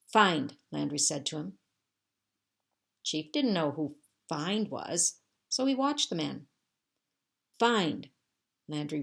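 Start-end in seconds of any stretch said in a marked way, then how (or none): 0.1-0.6 one loud, falling command word; firm command. A narrator's reading. Landry ordering Chief to search, firm and loud. 7.6-8.1 louder, higher one-word order; firm command. A narrator's reading. Landry repeating his order to Chief, insistent.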